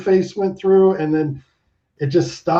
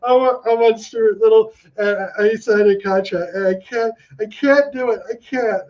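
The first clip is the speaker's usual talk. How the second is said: retold tearful pleading; 6 semitones higher than usual. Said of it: "tearing up and going".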